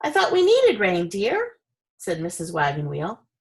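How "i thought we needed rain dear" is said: high, gentle wife voice; wife's voice. Acted. Wife mildly reminding her husband about rain, high and gentle. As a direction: say it sweetly from up high.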